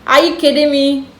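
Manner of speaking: usual speaking voice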